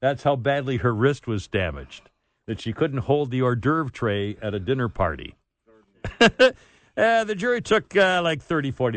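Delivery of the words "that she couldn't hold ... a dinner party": ordinary talk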